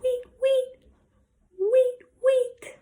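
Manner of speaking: very high little chirps; bird tweet. Shown as a demonstration. Teacher showing children what the bird says, high-pitched tweets. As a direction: peep it high up like a tiny bird. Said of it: "bird says".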